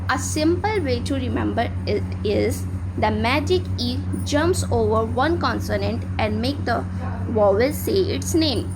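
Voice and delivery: slow, spaced-out memory rhyme; slow classroom rule; slow teaching rule. Shown as a demonstration. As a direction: say the rule slowly so it sticks. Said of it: "rhyme for remembering".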